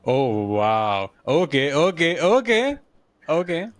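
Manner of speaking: usual speaking voice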